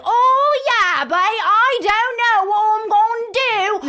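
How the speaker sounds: high, loud, drawn-out mock Cockney; horrible Cockney. Co-host mimicking Jessie's awful Christmas pageant accent, horrible Cockney. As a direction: wail it in an exaggerated, terrible Cockney. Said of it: "Jessie doing a horrible Cockney".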